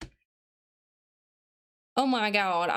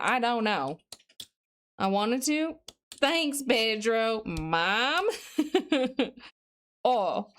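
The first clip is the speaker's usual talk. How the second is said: ordinary talk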